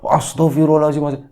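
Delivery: high-pitched gasped-out prayer word; startled exclamation. Acted. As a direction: react by reflex to a fright. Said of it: "refleks begitu".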